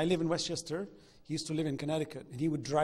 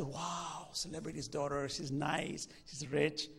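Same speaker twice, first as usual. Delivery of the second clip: softer, dreamy mock awe; starry-eyed; 6 dB quieter, slower than usual. A student dazzled by the celebrity's daughter, soft dreamy awe. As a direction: sigh it like a dazzled young man. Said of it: "get all starry-eyed and say".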